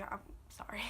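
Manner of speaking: whispered through tears; choked-up apology; tearful whisper. Real, not acted. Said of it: "I'm crying".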